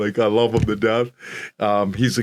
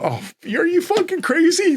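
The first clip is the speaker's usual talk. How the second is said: shrill jump to a much higher pitch, mock disbelief; incredulous border guard. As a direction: squeal it like a guard who can't believe it. Border guard reacting, retold.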